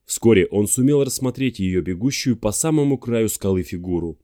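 ordinary delivery

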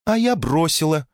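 light female voice. A narrator's reading. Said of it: "сказала Наэль".

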